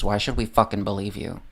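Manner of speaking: ordinary talk, nothing marked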